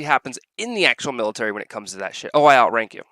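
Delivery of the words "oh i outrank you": mocking rank-puller. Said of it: "That shit, that always irritates me".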